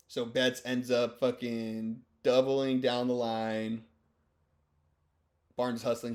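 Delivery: ordinary talk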